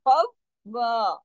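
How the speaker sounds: कौवा slow, waw doubled and its a held long; Quranic recitation. Shown as a demonstration. Teacher models the doubled waw in कौवा. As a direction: hold the a of कौवा an alif long. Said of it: "अलिफ की मिकदार के बराबर".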